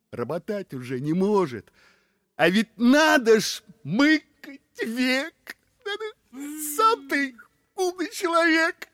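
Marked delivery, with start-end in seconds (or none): 2.4-8.9 wheedling. Acted. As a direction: plead in a high, stretched voice. Brother wheedling Ivan in story. (высоко, протяжно уговаривая)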